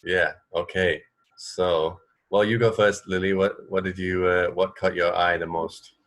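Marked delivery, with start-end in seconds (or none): none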